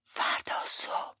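slow hushed whisper. Acted. Peggotty whispers to David when he must leave, slowly. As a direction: let it out softly, slowly. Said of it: "آهسته گفت".

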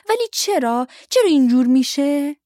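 put-on high, loud child's wail; forlorn wail. A narrator's reading. Helen asks her parents why, wailing forlornly. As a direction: wail it out like an upset little girl. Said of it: "هلن با دلتنگی زار زد".

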